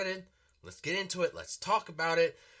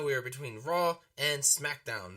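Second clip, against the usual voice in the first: normal talking voice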